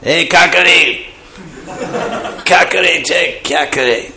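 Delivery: high, raucous mock holler; rough village shout. Village speech against sweet city language, high mock shouting. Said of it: "So the village is a different thing".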